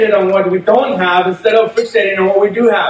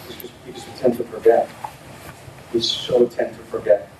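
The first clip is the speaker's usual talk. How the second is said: hushed admission; 9 semitones lower, 8 dB quieter than usual. Real, not acted. Softened confession to congregation.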